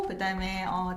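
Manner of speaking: normal talking voice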